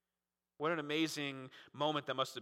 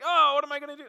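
mock panic; 10 semitones higher, 9 dB louder, much faster than usual. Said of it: "you're like".

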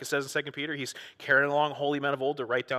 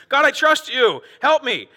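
higher and louder cry to God; pleading psalmist; 10 semitones higher, 9 dB louder, slower than usual. Retold or imitated. Pastor acting David praying for help, higher and louder.

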